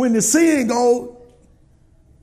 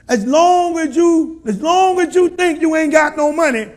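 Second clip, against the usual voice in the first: higher, louder preacher build; full-force preaching; 5 semitones higher, 7 dB louder than usual. Acted. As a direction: preach it to the congregation.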